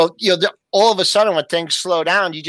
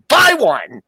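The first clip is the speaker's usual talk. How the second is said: louder, higher clipped buy order; curt phone order; 7 semitones higher, 6 dB louder than usual. Retold or imitated. Trader reversing his one-lot order by phone, mock urgent. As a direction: bark the order, a touch mock serious. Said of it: "He goes".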